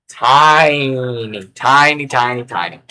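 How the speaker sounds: stretched-out, slow "Tiny"; long, playful stretch on one word; drawn-out word. Pretend. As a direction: draw the first vowel right out. Dwelling on how tiny print is.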